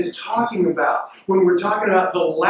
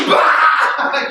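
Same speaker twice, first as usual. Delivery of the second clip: mock laugh burst; 4 semitones higher than usual. Shown as a demonstration. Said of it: "laugh like".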